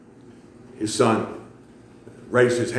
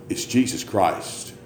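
usual speaking voice

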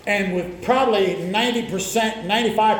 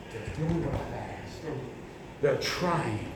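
softer, gentle voice of God; quiet tender God; 6 semitones lower, 12 dB quieter, slower than usual. Acted. God speaking approvingly of the faithful, softly.

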